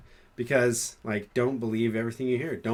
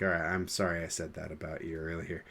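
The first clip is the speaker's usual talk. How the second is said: quieter, lowered voice; calm down into a low, soft apology; softer, sincere; 5 semitones lower, 6 dB quieter than usual. Retold or imitated. Bo-Katan apologizes to Mando after calming down, softer. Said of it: "now she's a little more softer".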